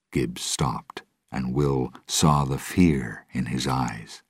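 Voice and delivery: ordinary delivery, nothing marked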